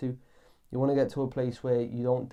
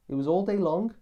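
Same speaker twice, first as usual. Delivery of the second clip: higher, louder, pressing the point; emphatic exclaim; 7 semitones higher, 5 dB louder than usual. Acted. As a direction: hammer home how long it lasted. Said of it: "have I painted the picture accurately?".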